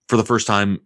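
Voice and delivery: usual speaking voice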